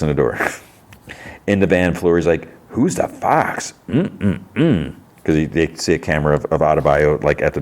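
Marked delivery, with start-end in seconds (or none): 2.7-4.9 high, drawn-out mock ogling; mock leering. Fleury ogles a visitor on the van camera, mock leering. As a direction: savour it slowly like a playful leer. Retells leering remark about woman.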